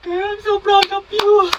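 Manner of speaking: high, slow gushing praise. Acted. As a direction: swoon over your bestie. Gushing over bestie. (kinikilig na papuri)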